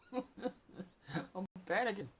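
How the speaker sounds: slow, laughing through the words; laughing, drawn out. Real, not acted. Admitting his own panic over lost money, slow and laughing. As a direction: let it dissolve into a slow laugh.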